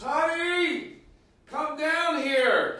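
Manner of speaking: high wife voice calling upstairs; calling from far away. Acted. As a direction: shout up to someone in another room.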